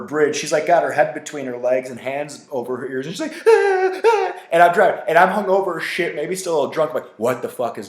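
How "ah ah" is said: very high mock yelps of fear; panicked cries. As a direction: imitate a friend terrified of bridges. Patty panicking on the bridge.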